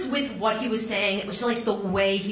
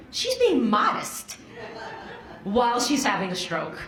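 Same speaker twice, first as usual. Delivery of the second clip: laughing disbelief; 3 semitones higher than usual. Retold or imitated. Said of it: "I'm like".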